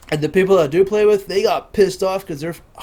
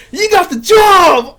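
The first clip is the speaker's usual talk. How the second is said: high, loud, slow cheer of "You got the job! Awesome!"; shouting with pretend joy, much higher and louder; excited shout; 10 semitones higher, 8 dB louder, slower than usual. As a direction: yell the good news like a thrilled manager. Mock caller announcing the job.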